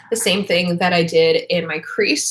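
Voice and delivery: ordinary delivery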